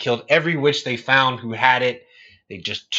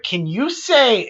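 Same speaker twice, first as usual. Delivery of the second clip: high, slow singsong prompt; pitched-up Dora asking the audience; kid Dora; 9 semitones higher, slower than usual. Retold or imitated. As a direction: imitate a kids' show host asking viewers. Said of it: "she's doing that thing like".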